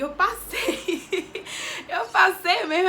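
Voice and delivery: real laughter breaking through the words; laughing with joy. Real, not acted. Telling her instructor she passed, laughing happily. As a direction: laugh out the good news.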